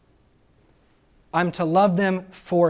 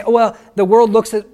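louder, higher, half-mocking remark from the world; mock onlooker's voice; 5 semitones higher, 11 dB louder than usual. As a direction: lightly play a scornful outsider. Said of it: "You could say".

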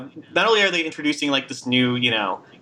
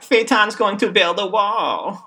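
higher, louder, slow blustering rally voice; a hammed-up red-hat blowhard, drawn out; weird politician voice; 8 semitones higher, 4 dB louder, slower than usual. Mocking a red-hat villain with a strange blustering voice. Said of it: "I don't know what that voice was".

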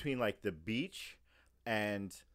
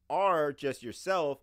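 normal talking voice

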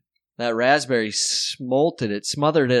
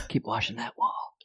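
whispered; 5 semitones lower, 9 dB quieter than usual. Pretend. Damon voiced in comic scene.

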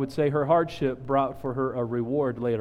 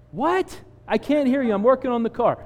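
mock innocence; 8 semitones higher, 3 dB louder than usual. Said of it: "And I'm like".

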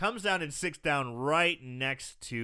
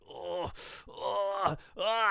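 pained groan; 5 semitones higher, much slower than usual. Retold or imitated. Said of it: "He's like".

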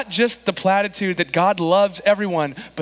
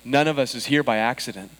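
prayer; 4 semitones lower than usual. Acted. Pastor's opening prayer.

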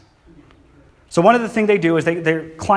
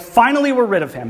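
relieved cheer; 8 semitones higher than usual. Retold or imitated. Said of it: "they're like".

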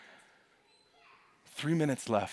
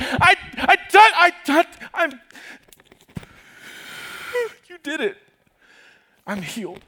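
overcome; 13 dB louder than usual. Acted. Woman overcome, faltering.

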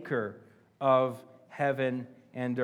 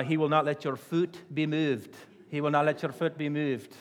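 a light Irish accent, a hint of the Scottish-Irish pastor; lightly put-on Irish accent, quoting a favourite preacher; Alistair Begg's Irish voice. Pastor quoting the psalm to the church, with a light Irish accent. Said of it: "reading it in Alistair Begg's Irish voice".

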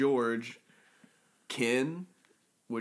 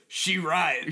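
lightly voiced pimp drawl; a hint of pimp swagger; 3 semitones higher than usual. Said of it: "Pimp says".

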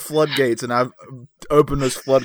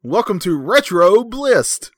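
stretched-out, loud, higher show-opening greeting; signature show intro; 5 semitones higher, 4 dB louder, much slower than usual. Shown as a demonstration. Host demonstrating a well-known line for a friend to repeat, signature intro. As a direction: belt it out slowly like a catchphrase. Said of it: "a line I'm well known for".

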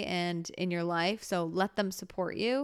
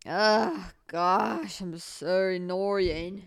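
self-exasperated groan; 4 dB louder, much slower than usual. Real, not acted. Exasperated at herself after laughing.

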